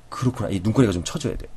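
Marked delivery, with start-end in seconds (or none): 0.1-0.5 soft, quiet 그렇구나 falling away; hushed, sympathetic sigh of understanding; sad sympathy. Shown as a demonstration. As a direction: share their sorrow gently. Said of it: "슬플 때".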